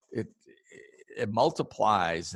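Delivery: ordinary delivery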